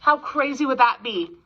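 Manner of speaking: ordinary delivery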